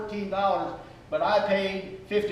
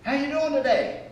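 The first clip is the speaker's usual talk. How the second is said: cheery greeter; 7 semitones higher than usual. Acted. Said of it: "a critical attitude or a complaining attitude".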